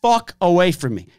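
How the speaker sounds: security guard yelling at filming bystanders; retold yell. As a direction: shove them back with the voice. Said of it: "I literally was like".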